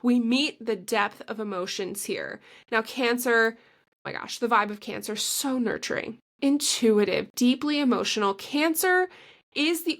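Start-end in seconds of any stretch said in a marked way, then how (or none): 3.6-4.4 whispered aside. Real, not acted. Gushing about Cancer vibe.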